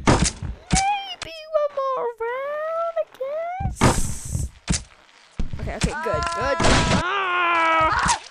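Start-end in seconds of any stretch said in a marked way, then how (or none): 0.1-4.5 high, very slow whiny drawl; reluctant. Real, not acted. Gamer gives in for another round, high whiny drawl. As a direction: stretch it out in a high sulky whine.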